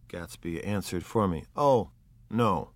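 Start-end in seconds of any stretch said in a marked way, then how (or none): none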